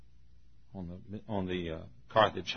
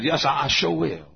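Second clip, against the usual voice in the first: higher Southern dialect voice; imitated Southern deacon; 4 semitones higher, 4 dB louder than usual. Deacon agreeing to gather a crowd, raised Southern dialect. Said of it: "This is the way all of us Southerners talk".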